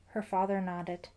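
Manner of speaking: plain reading voice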